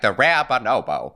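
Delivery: put-on old Hollywood transatlantic voice; clipped classic movie star accent. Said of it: "I heard this transatlantic thing, voice in my head".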